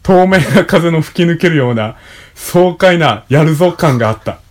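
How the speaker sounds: loud, clear reading of a text; reading aloud. Acted. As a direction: read it out like an essay. Reads a passage aloud.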